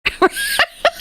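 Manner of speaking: high, voiceless giggling; real laughing fit. Real, not acted. Storyteller cracking up over the police call, high giggles.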